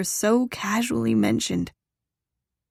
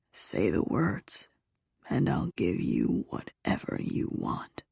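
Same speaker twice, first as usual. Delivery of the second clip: very low, soft husky voice; male lover, coaxing; 12 semitones lower, 6 dB quieter than usual. A narrator's reading. Lover promising whatever she wants, low coaxing murmur.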